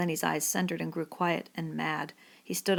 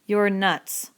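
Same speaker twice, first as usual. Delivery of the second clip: louder scornful "You're nuts."; 2 semitones higher, 6 dB louder than usual. A narrator's reading. Crooks mocking Lenny's dream, scornful. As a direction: dismiss him with contempt. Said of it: "Crooks was scornful".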